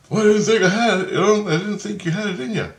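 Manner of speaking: high, loud quoted amazement; acted astonishment. Companion praising the teller after the fight, astonished.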